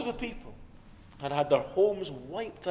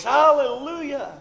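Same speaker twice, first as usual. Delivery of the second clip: higher, louder mock yell of praise; yelled hallelujah; 7 semitones higher, 7 dB louder than usual. Shown as a demonstration. Preacher mocking noisy praise with a yell. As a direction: belt it out like a crowd cheer. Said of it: "to yell at the top of your voice".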